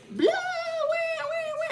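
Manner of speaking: high, wailing fake bawl; exaggerated cartoon wah-wah crying; mock sobbing. Narrator mimicking heartbroken boy in principal's office, mock sobbing. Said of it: "he's sobbing".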